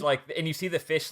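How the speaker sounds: usual speaking voice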